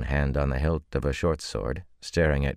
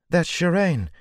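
high, loud cry of alarm; loud distressed cry; 5 dB louder than usual. A narrator's reading. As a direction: call out her name in shock. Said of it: "the pretty woman cried".

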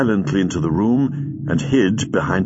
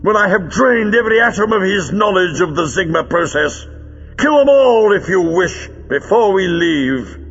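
high-pitched villain calling out with relish; ghoulishly jovial villain, pitched well up; gruesome good humour; 9 semitones higher than usual. A narrator's reading. Villain taunting his sulking henchman in the idol, gruesome good humour. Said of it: "With gruesome good humour, Greel called over".

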